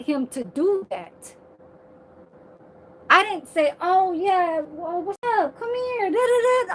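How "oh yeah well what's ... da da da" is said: sing-song, high imitation of flirting; high, sarcastic fake invitation; mock come-on. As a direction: parody inviting someone over, pitch climbing. Mocking a come-on she never made.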